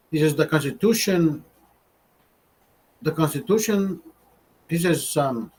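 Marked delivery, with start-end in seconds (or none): 0.4-4.0 put-on Mexican-style accent. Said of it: "he has a strong accent, Mexican".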